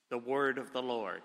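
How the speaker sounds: usual speaking voice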